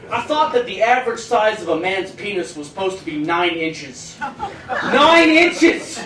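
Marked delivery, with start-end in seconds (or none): 4.7-6.0 incredulous exclamation. Acted. Shocked at supposed average size.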